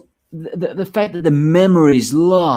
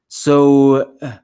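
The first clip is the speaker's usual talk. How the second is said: talking through a yawn; sleepy, stretched-out yawn; 2 semitones higher than usual. Real, not acted. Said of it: "That's why I'm yawning so much".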